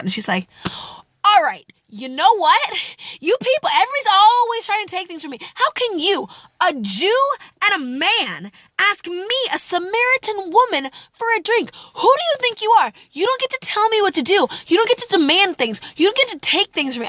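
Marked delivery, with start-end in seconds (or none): 1.2-5.4 high-pitched, fed-up sass; sassy outburst. Acted. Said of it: "all the sass in this poor, broken woman comes out". 5.5-11.7 high, scornful challenge to the man asking; indignant sass. Acted. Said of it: "she's not like". 11.9-17.1 high-pitched, fed-up sass; sassy outburst. Acted. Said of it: "this is the way that I read her".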